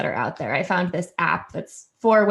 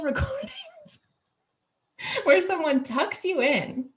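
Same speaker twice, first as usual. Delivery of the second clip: ordinary talk, nothing marked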